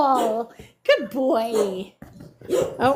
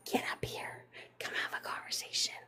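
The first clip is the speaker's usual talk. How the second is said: coaxing whisper to a viewer; soft whispered coaxing; 18 dB quieter than usual. Real, not acted. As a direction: whisper the invitation.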